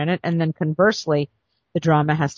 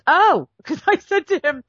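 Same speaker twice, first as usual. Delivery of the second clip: high excited gasp of delight; mock excited oh; 9 semitones higher than usual. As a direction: burst out thrilled. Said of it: "he was very excited because he goes".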